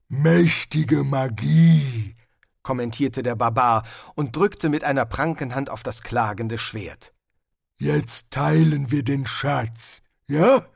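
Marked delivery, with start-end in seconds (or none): 0.1-2.1 slow, loud, lumbering barbarian voice; a big brute savouring his words; Hrun, the barbarian. Acted. Barbarian comments on the sword. 7.8-10.6 slow, loud, lumbering barbarian voice; a big brute savouring his words; Hrun, the barbarian. Acted. Barbarian proposes sharing the treasure.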